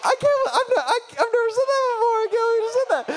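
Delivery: high, rushed, half-laughing wail; mock horror. Preacher appalled at what he just promised, comic high-pitched panic. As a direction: babble in hammed-up dismay. Retold horror at his own claim.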